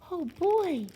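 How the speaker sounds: a small high cheer; baby bear. A narrator's reading. Baby Bear cheers the treat, lightly done high voice. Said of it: "said Baby Bear".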